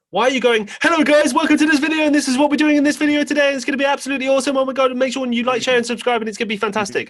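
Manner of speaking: very high, loud, rushed intro; over-hyped YouTuber. Retold or imitated. Cohost mocks YouTubers who shout their intros at adults. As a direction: gush like an overexcited kids' YouTuber. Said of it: "I'm thinking".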